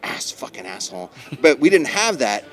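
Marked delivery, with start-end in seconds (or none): 0.0-1.1 whispered curse. Retold or imitated. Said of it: "and she goes".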